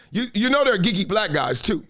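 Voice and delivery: ordinary talk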